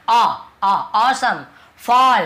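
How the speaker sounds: "awesome" and "फॉल" with long, rounded aw; demonstrating aw sound. Shown as a demonstration. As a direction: drill the aw vowel in "awesome", "फॉल". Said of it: "अ ध्वनि निकल रहा है".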